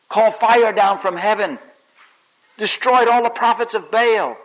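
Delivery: loud, high preaching climax; thundering. Acted. Preacher extolling Elijah's might, loud and thundering. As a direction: let it ring out like fire from heaven.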